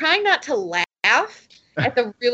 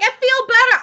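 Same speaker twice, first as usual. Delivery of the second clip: high, loud, mock-relieved squeal; relieved prostitute; 11 semitones higher, 5 dB louder than usual. Quoting the movie prostitute after the bathroom scene, mock relief. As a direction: play her relief big and silly. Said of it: "She's like".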